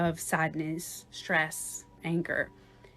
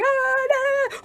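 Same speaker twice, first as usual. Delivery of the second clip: loud, very high "ah-da-da" squeals; excited squealing; 6 dB louder than usual. Acted. Overexcited people walking into a room, shrill hyped squealing. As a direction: burst in with hyped-up babble. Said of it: "very high frequency and very excited".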